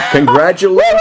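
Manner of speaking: loud, high shout of congratulations. Real, not acted. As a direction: cheer it out to the winner.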